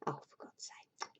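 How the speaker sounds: whispered under the breath in despair; exasperated whisper. Real, not acted. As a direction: sigh it out as a whisper. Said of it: "exasperated whisper aside".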